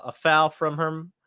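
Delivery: normal talking voice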